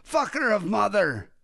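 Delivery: retold cab driver yell, hammed up a little; mock angry yell. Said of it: "He kept yelling".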